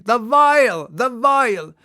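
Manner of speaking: high, loud terrified shriek; piercing shriek. A narrator's reading. Gabriel shrieking to halt the crew, high and loud. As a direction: shriek it out in terror. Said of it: "Raising a piercing shriek".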